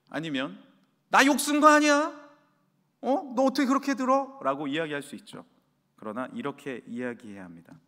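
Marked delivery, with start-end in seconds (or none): 1.1-4.4 loud, much higher, indignant denial; defensive outburst. Acted. Mother denying the curse to her child, loud and indignant. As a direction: defend yourself hotly.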